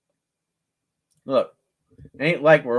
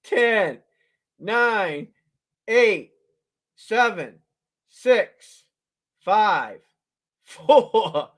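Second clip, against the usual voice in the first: higher, louder countdown calls; 6 semitones higher, 4 dB louder, much slower than usual. Real, not acted. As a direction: call out each number to bidders. Auction countdown called out.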